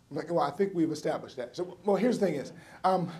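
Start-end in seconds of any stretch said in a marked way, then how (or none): 0.3-1.5 low, soft deadpan; deadpan aside, quiet and low; dry retort. Retold or imitated. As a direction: undercut her with flat sarcasm. Dry reply to mother.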